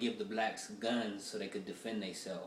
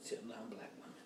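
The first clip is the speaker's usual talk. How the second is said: real whisper, much softer; whispered aside; 12 dB quieter than usual. Real, not acted. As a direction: drop to a secret whisper. Aside, voice dropped right down.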